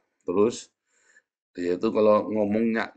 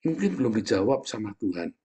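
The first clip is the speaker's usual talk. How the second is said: ordinary talk, nothing marked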